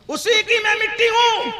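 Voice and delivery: the broken cup's words cried out high and slow; booming shout. Shown as a demonstration. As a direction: thunder it out so the hall hears. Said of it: "دھیان سے سننا". (پیالے کی آواز میں زور سے پکار)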